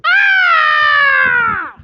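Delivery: high piercing mock scream; demon scream. Preacher imitating a possessed person's scream. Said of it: "You're gonna hear screaming like".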